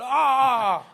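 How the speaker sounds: loud, long "aah"; imitated shout. Doctor shouts just like his patient, loud imitated shout. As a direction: echo the patient's cry loudly. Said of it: "the doctor also shouted".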